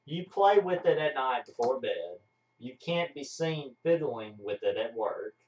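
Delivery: normal talking voice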